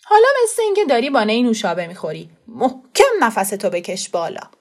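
put-on fast, loud know-it-all kid; instructing boy. A narrator's reading. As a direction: coach the first puff eagerly. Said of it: "خسرو با دقت ... گفت".

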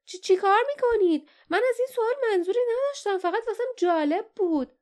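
high-pitched stammering protest; halting, broken speech. A narrator's reading. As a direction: stumble over the first word, voice shooting up. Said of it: "لیف بریده بریده گفت".

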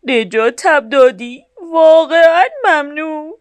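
the fat truck thanking little Blue for the rescue; grateful big truck. A narrator's reading. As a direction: thank him from the heart. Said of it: "کامیون چاق گفت به آبی جون".

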